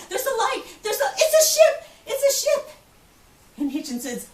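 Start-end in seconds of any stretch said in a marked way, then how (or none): 0.1-2.7 much higher and louder joyful cry; excited yell. Retold or imitated. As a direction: shout the sighting with joy. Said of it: "one woman yelled".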